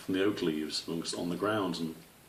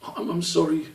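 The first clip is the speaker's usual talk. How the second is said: sorry; 10 semitones higher than usual. A narrator's reading. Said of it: "hanging his head".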